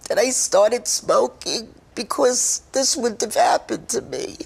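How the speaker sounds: high, tearful voice breaking through the words; fighting back real tears, voice cracking high; emotional crying. Real, not acted. Former smoker tells viewers she longs to see, crying. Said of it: "I'm sorry, I get very emotional".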